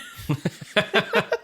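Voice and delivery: short high giggle; light laugh. Real, not acted. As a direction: giggle at the story. Laughs after quote.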